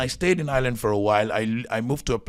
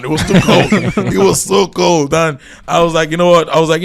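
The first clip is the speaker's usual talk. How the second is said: laughing loud retelling; 7 semitones higher, 11 dB louder than usual. Real, not acted. Still laughing about cold.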